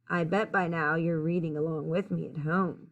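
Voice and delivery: ordinary delivery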